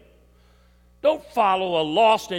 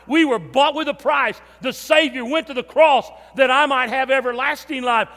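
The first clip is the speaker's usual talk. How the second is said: louder, building pulpit voice; fervent preaching climax; 4 dB louder than usual. Acted. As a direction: build the fire toward the cross. Preaching at full force.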